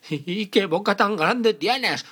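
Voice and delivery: put-on high, loud frightened child; little girl voice. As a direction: ask it scared and loud. Caperucita's last question.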